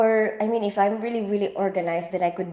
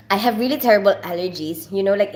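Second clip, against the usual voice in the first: ordinary talk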